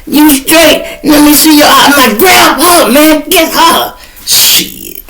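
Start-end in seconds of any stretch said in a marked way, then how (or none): 0.1-1.9 concerned shout. Retold or imitated. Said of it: "He like". 2.2-4.0 high urgent shout; blinded and shouting for his friend to catch her; frantic yell. Retold or imitated. Yelling to catch attacker.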